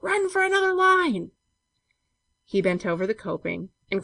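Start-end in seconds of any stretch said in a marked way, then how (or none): 0.0-1.3 high, loud, sustained shout; frantic high-pitched call; cried out. A narrator's reading. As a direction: yell the order after him urgently. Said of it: "cried George".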